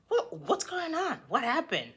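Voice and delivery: high, fake-surprised "what happened"; mock innocent boyfriend. Cheating boyfriend feigning surprise at her, high voice.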